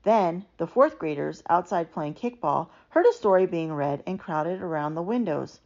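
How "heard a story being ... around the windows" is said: usual speaking voice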